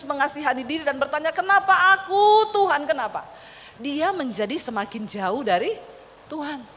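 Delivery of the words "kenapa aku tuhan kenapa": tearful why me. Retold or imitated. Weeping widow pleading with God, self-pitying wail. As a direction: stretch out aku like a sob. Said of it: "setiap hari dia menangis".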